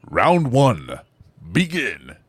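big, loud game announcer voice; fight announcer. Acted. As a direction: boom it out to open the round. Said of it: "an announcer gig that I was doing".

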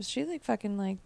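normal talking voice

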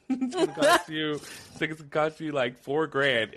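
lightly voiced hooker. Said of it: "She was like".

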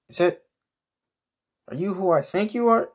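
high, amazed recognition; can't believe who's at the bar; starstruck surprise. Acted. Man recognising Medusa at the bar, high amazed surprise.

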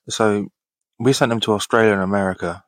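ordinary talk, nothing marked

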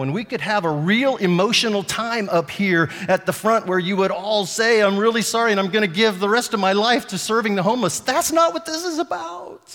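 forceful sermon delivery; preaching at full force. Acted. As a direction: build to a strong insistence.

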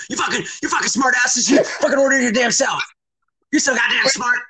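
high-pitched retold rant; hammed-up cursing outburst; mock angry dad.

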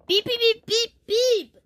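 high beeping burst; imitated alarm beeps. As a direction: beep like an alarm going off.

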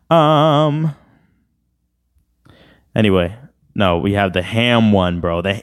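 ordinary talk

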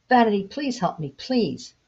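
Love pleading with Vanity from the water. Acted. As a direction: beg her, with rising desperation. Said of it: "Love pleaded".

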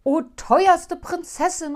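loud, slow, high cry; a loud, drawn-out plea; eager call. Acted. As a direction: cry out to her in hope. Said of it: "rief der Kalif".

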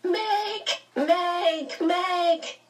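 very high sobbing cries; a child's sobbing shriek of her name; high sobbing calls; shrieking with sobs. A narrator's reading. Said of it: "He was shrieking with sobs".

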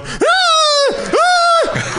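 put-on very high shrieks like a girl in her sleep; imitated girly scream. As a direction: mimic a friend screaming in his sleep. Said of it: "screaming like a girl in your sleep".

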